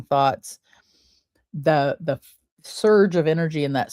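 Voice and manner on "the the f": ordinary talk, nothing marked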